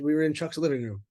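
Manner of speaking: ordinary talk, nothing marked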